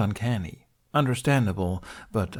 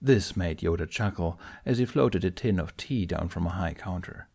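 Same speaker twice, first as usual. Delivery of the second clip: ordinary delivery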